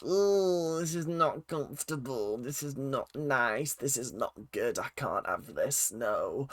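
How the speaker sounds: quiet, put-on Northern English accent. Reviewer recalling the awkward dinner argument, in a northern accent. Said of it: "I went northern there".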